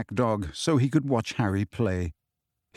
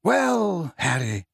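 put-on higher, measured elderly voice; lightly voiced old headmaster; 4 semitones higher, much slower than usual. A narrator's reading. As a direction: gently open as the old wizard. Dumbledore gently addressing Harry.

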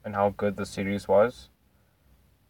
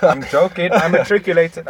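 laughing; 8 semitones higher, 8 dB louder than usual. Real, not acted. Laughing after self-mocking joke.

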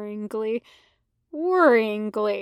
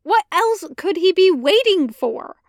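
higher, louder indignant question; defensive Anakin; 6 semitones higher, 6 dB louder than usual. Acted. Anakin to Ahsoka, louder higher indignant question. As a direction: insist it's obvious.

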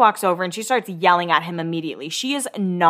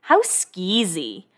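mock disgust; slower than usual. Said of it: "she's like".

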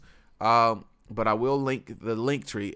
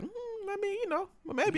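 high falsetto mimic; 7 dB quieter than usual. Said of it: "K D was like".

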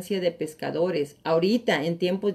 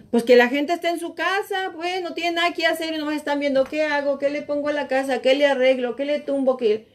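retold friend, higher; 6 semitones higher than usual. Friend explains, retold dialogue.

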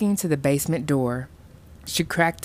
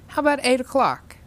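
put-on perky girl; 10 semitones higher, 3 dB louder, faster than usual. A narrator's reading. Girl perkily sets dinner time.

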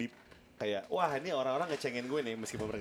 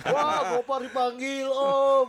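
much higher, louder, slow mocking cheer; mocking fans; 13 semitones higher, 10 dB louder, much slower than usual. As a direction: crowd jeering him as Om. Mocking fans' comments.

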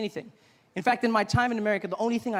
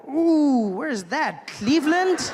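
mock curious; 7 semitones higher, 7 dB louder, slower than usual. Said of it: "I'm like".

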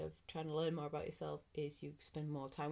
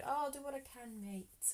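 higher, breezy British "Oh, I'll do what I can, mates"; mock British brush-off; 7 semitones higher than usual. Vlogger mocking the British empty promise, breezy British accent. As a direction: toss it off like a noncommittal Brit. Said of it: "very British".

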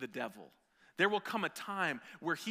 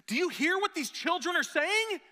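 indignant priests; 9 dB louder than usual. Retold or imitated. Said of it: "they asked Jesus".

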